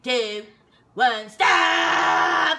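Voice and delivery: loud count bursting into a yell; countdown into shout. Retold or imitated. Sergeant ending the bag search, shouted countdown. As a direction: count down, then bellow the last word.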